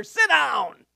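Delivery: sudden high acted yell; irate heckler's command; angry crowd order. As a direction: order him down sharply. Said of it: "they charged him and said".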